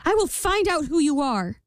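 high-pitched vow; determined queen. A narrator's reading. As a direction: challenge the imp as the queen. Queen challenges the imp.

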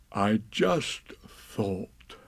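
very slow musing; slow Pooh. Acted. Pooh alone with Piglet, very slow musing. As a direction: drift off in thought.

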